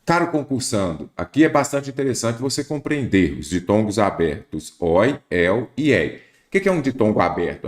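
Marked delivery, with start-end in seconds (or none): none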